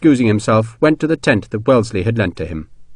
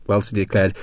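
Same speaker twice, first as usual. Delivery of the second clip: ordinary delivery, nothing marked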